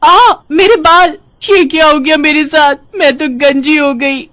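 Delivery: high, loud shriek breaking into tears; horrified wail. Acted. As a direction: scream in horror at your lost hair. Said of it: "वो चिल्लाते हुए कहती है". (चिल्लाते हुए रोना)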